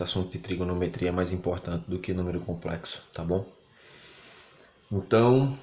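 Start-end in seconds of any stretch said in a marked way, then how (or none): 4.9-5.6 talking through hiccups. Real, not acted. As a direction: catch a hiccup as you speak. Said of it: "tô com soluço".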